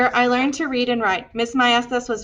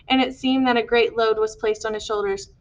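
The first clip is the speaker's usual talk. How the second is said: ordinary delivery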